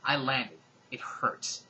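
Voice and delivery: usual speaking voice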